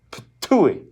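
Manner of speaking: put-on old woman spitting in disgust; spitting sound. A narrator's reading. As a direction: spit it out with a pitched ptooey. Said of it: "Madame Zeroni spat on the dirt".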